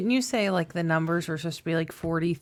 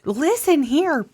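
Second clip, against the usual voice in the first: much higher, louder, slow furious mom; mock furious mom; 11 semitones higher, 6 dB louder, much slower than usual. The real mom yells at the prankster, slow furious shout. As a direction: blast it like an enraged mother. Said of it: "And she's like".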